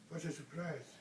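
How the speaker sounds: usual speaking voice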